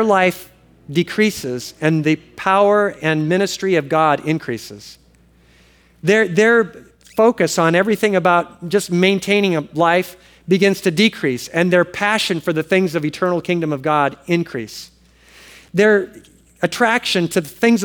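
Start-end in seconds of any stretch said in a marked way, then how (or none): none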